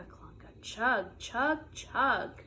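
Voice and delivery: ordinary delivery, nothing marked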